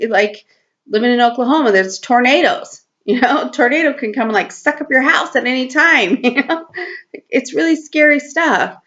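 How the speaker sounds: normal talking voice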